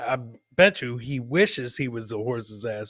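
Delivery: usual speaking voice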